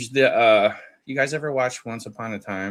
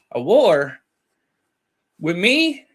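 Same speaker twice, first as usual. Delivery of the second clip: incredulous; 7 semitones higher, 7 dB louder, slower than usual. Retold or imitated. Shocked retort to a threat.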